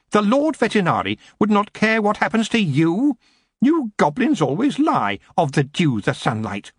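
cold, imperious queen voice, pitched high; haughty elf queen. A narrator's reading. Queen to goblin prisoner, imperious and cold. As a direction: speak down to him like a queen.

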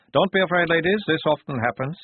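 hearty corporal calming the ladies; reassuring corporal. A narrator's reading.

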